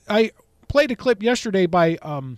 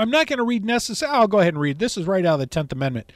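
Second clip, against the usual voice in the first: ordinary talk, nothing marked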